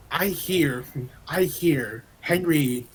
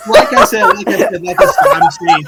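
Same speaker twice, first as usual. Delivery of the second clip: laughing; 13 dB louder than usual. Real, not acted. Laughing while explaining himself.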